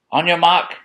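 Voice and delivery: high, loud starting call; kookaburra race starter. Acted. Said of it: "said the kookaburra".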